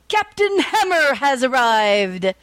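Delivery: slow, grand hero voice; heroic announcement. A narrator's reading. Captain Hammer bursting into the robbed bank, slow and grand. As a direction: announce it slowly like a pompous hero.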